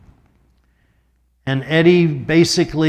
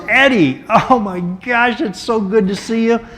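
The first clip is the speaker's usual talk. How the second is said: Truman's warm greeting; 6 semitones higher than usual. Retold or imitated. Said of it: "Harry looks up and he goes".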